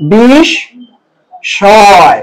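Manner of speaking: বিষ, ছয় said in two parts as bish-shoy with a nasal ending, no m; pronunciation demo. Shown as a demonstration. Teacher splitting the word for students, no m, nasal ending. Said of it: "এটা উচ্চারণ হবে এরকম".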